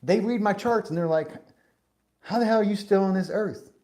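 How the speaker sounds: loud, high imitation of a Southern old-timer; thick Southern accent. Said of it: "he had a really thick Southern accent".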